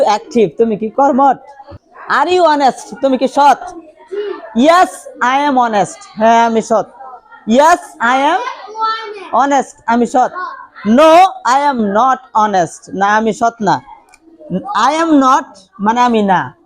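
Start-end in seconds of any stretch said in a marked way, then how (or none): 4.6-6.0 very slow, each word laid out for the class; slow classroom demo; slow model answer. Shown as a demonstration. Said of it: "teacher models the answer slowly". 10.9-13.0 very slow, with "No" falling; slow dictation of a no-answer; slow model answer. Shown as a demonstration. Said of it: "teacher models the negative answer".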